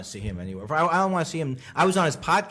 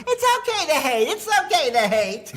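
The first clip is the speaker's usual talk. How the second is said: high, smug put-on voice; mock Leno; 14 semitones higher, 5 dB louder than usual. Leno forgiving the comic, high smug voice. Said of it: "And Jay Leno goes".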